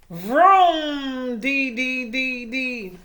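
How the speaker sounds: loud vroom then sing-song dee dees; engine vroom. A narrator's reading. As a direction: belt out a motor revving. Engine noise sound effect.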